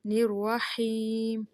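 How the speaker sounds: الرحیم ending on a clean م without ghunna; no nasal meem. Shown as a demonstration. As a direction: stop الرحیم on a plain م. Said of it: "میم جو ہے وہ ناک میں نہ پڑا جائے". (میم بغیر غنہ)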